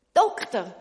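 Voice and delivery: one loud call of a name. Acted. Shoemaker calling to the doctor, loud call. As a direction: call out across the water. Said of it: "ruft der Schuster".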